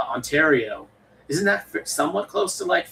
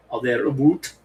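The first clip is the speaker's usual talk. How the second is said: mock Canadian accent on "about"; put-on Canadian vowel, "about" as "aboot". Shown as a demonstration. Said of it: "try and say the O U sound".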